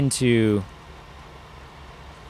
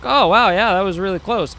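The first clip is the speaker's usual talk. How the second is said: mock relief; 8 semitones higher, 3 dB louder than usual. Imagined reaction.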